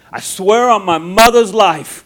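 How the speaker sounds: loud, high, slow attempt at an Italian accent; hammed-up Italian oath; Italian coworker impression. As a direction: swear it big in a rough Italian accent. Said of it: "my voice is changing when I do his voice".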